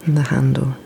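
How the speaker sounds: ordinary delivery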